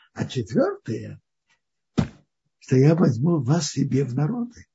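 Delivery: ordinary talk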